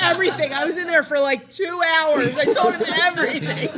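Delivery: emphatic, laughing. Retold or imitated. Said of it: "He's like".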